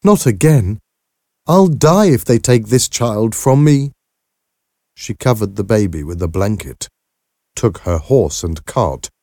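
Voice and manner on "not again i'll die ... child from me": high, anguished plea; desperate. A narrator's reading. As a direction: protest in despair, voice raised in pitch. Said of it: "said to herself in desperation".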